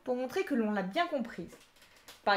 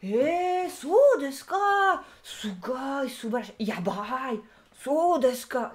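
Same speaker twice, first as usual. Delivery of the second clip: mock excited Japanese; 2 semitones higher, 6 dB louder, much slower than usual. Teacher mimicking Japanese people reacting to everything, exaggerated. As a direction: imitate an over-eager listener gushing. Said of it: "dès que tu leur parles".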